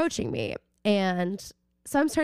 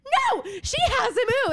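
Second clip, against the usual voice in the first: much higher, defiant refusal; high shrill no, re-enacted; retold shriek; 13 semitones higher than usual. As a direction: refuse your friend in a panicked scream. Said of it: "and I was like".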